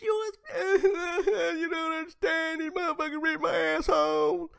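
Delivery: very high, loud fake bawling; mock crying. Host imitating the crying woman, high mock bawling. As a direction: bawl it out in parody.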